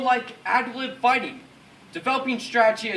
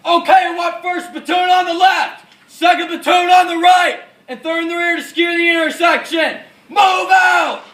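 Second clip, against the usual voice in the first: high, forceful battlefield command; shouted orders; 8 semitones higher, 12 dB louder than usual. Acted. Officer deploying his platoons for the attack, shouting. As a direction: bellow the orders to the whole unit.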